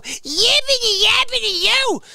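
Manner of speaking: high, loud, whiny taunt; mock yapping. Mocking the customer's yapping, high and loud. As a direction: squeal it like a brat. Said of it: "You go".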